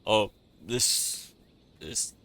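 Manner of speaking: usual speaking voice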